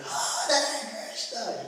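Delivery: high, hoarse mock crowd yell; playful imitation of a screamed chant; imitated yelling. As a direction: act out kids chanting at the top of their voices. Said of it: "yell it to the top of their voice".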